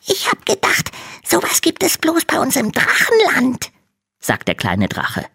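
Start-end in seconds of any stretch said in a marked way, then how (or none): 0.1-3.7 very high small voice with a cry in it; a tiny dragon near tears; tearful little dragon. Acted. Little dragon tells Hanno why he ran, teary.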